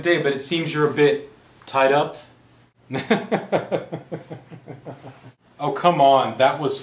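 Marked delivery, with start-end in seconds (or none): 2.9-4.3 chuckle. Acted. Kidnapper after his tied-up pun, chuckling. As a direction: run of short chuckles.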